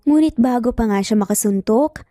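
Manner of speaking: ordinary delivery, nothing marked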